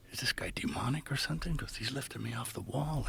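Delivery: whispered, worried aside to the priest; a soft, fearful whisper; hushed worried question. Retold or imitated. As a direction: ask the priest under the breath. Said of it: "I said".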